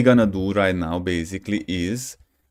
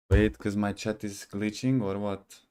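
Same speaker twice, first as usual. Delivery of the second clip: ordinary delivery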